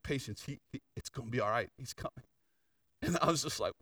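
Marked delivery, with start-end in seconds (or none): 0.0-2.2 soft hushed voice. Retold or imitated. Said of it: "the Holy Spirit whispers to me".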